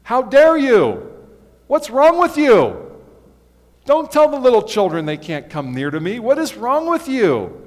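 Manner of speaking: loud, high indignant outburst; loud furious scolding of disciples; indignant Jesus. Retold or imitated. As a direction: rebuke them as if truly outraged. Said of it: "He was indignant".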